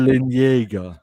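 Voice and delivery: slow, drawn-out "Eren Yeager" like an anime hero; mock anime voice. Co-host repeats the gag name in a slow mock anime voice. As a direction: ham the name up.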